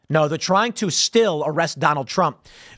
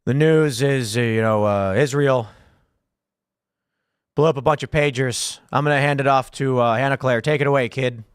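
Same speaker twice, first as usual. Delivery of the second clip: mock tired anchor; 4 semitones lower than usual. Host pretending to run his show like Biden, halting and lower. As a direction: fumble through the news, then hand it off. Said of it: "I'll sit here and say".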